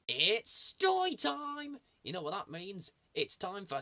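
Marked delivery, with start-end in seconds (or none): 0.1-1.8 slow, loud, high, sung-out announcement; excited sing-song. Real, not acted. Presenter calls kids to story time, slow sing-song.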